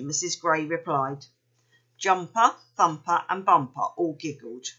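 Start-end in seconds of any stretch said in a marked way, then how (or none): none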